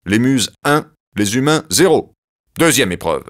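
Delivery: Zeus keeping score loudly; Zeus announcing. Acted. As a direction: call out the score like a judge.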